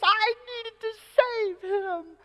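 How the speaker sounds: slow, high-pitched mock sobbing; hammed-up tearful little girl, stretching the words; wailing child. Daughter mourning the frog to dad, wailing.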